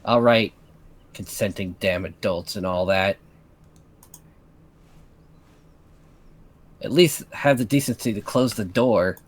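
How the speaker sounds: low, sour resentment; bitter. Acted. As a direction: grumble it with bitter scorn. Catherine bitter about the lovers.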